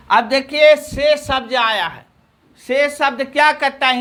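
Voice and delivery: usual speaking voice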